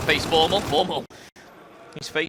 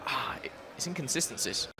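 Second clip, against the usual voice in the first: breathy half-whispered aside; whispering; 9 dB quieter than usual. Real, not acted. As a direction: drop to a whisper. Trails off into a whisper.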